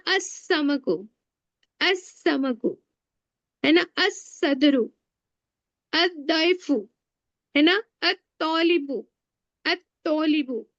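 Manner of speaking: असमकु, असदरु, अत्तौलिबु with the lam silent and a doubled consonant; modeling sun letters for students; sun letter examples. Shown as a demonstration. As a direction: swallow the l into the next letter. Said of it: "अल में का लाम नहीं पढ़ेंगे".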